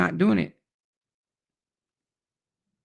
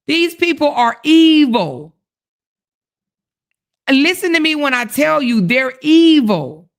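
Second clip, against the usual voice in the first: outraged insistence; 6 semitones higher than usual. Real, not acted. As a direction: drive home that they're evil. Rant climax about commenters.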